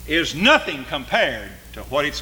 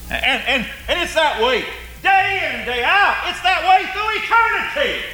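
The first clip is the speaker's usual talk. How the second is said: preaching at full force; 13 semitones higher than usual. Acted. Preacher warning his congregation of hell's torment, full force. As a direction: preach the torment, voice climbing high.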